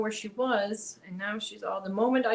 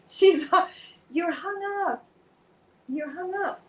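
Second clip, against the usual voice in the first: pet talk; 8 semitones higher, 4 dB louder than usual. Real, not acted. Speaking to tangled cat.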